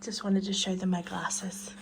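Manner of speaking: soft, slow whisper. Real, not acted. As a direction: whisper it slowly as a secret. Aside about dog's glasses.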